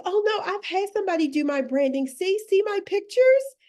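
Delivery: high, loud mock proud client; proud lady quote. Coach mimics a client bragging about her branding, high mock pride. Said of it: "she was like".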